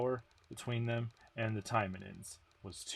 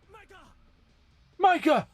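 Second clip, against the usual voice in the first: much higher, loud shout of her name; calling Micah; 13 dB louder than usual. Acted. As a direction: yell her name across the field. Calling out in battle.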